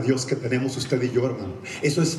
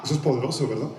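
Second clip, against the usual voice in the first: normal talking voice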